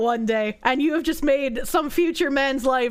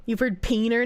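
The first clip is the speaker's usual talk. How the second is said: usual speaking voice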